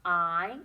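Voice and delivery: the word I said loud and firm; stressed word. Shown as a demonstration. Teacher stressing a sight word for kids, loud. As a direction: land hard on the word I. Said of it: "nice word for you to know".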